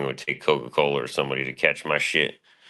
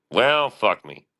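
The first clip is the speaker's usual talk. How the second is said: higher, louder mock exasperation; mock dismay; 8 semitones higher, 4 dB louder, much slower than usual. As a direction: throw up your hands in fake defeat. Caught reading a dox aloud.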